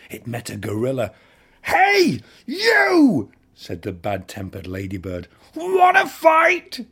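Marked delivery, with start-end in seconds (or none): 1.6-3.3 challenging shout. A narrator's reading. Ladybird picks a fight. 5.5-6.8 challenging shout. A narrator's reading. Ladybird picks a fight.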